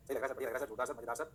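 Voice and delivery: low, quiet string of approval; student agreeing. Acted. Students reassuring the teacher they follow, low and subdued.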